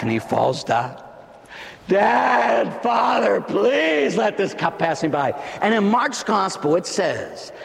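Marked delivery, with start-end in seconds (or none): 1.9-5.3 Jesus begging his Father a second time, shouting. Acted. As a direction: cry out to your father for mercy. Jesus pleading again.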